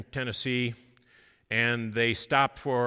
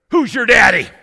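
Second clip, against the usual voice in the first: loud, much higher shout; big booming voice; 10 semitones higher, 19 dB louder than usual. Retold or imitated. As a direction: boom the question out. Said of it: "a big booming voice says to me".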